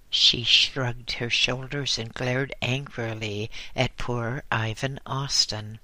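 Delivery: usual speaking voice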